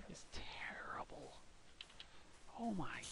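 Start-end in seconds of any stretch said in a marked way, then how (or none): 0.1-1.3 slow mock-grave whisper. Gamer mock-grimly whispering about creepers. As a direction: breathe it out slowly, voiceless.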